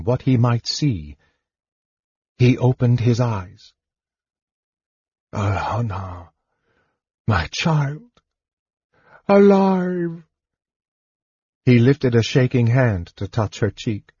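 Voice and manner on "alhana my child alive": frail father overcome; awed disbelief. A narrator's reading. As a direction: build from hushed recognition to amazed joy. Tormented father sees daughter alive.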